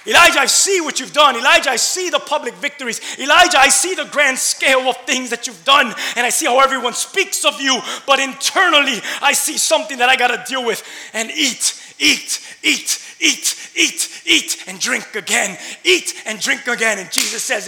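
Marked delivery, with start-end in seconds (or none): 0.1-10.8 loud, high, driving sermon voice; full-force preaching. Acted. Pastor preaching to his congregation, loud and driving. 11.1-17.0 loud, high, driving sermon voice; full-force preaching. Acted. Pastor preaching to his congregation, loud and driving.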